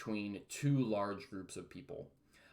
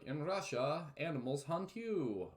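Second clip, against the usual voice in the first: mock Russian joke; 6 semitones higher, slower than usual. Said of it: "you might know the stereotypes".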